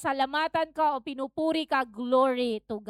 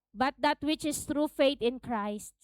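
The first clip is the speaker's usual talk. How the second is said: ordinary delivery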